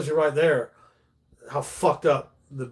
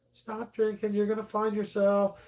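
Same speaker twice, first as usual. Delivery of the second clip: higher, soft and kind; sweet motherly advice; 8 semitones higher, 3 dB quieter than usual. Retold or imitated. Mitch's mother telling the drunk comic, higher and softer. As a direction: gently coax him like a caring mother. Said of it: "She was just so sweet to me".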